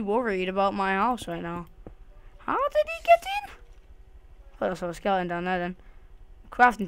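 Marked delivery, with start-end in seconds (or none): 2.4-3.5 very high squeaked question; squeaky panic. Real, not acted. Worried player asking again in a high squeak. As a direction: squeak it out in disbelief.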